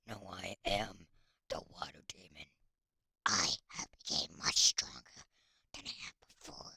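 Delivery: low, breathy villain whisper; menacing demon whisper; water demon whisper. Acted. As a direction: hiss it like a lurking demon.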